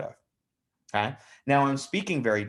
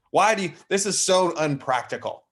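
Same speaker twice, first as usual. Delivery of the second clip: louder, higher acted-out indignation; mock exasperation; 6 semitones higher, 6 dB louder than usual. Greens judging the blues' silly watch, exasperated. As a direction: protest like an exasperated stickler.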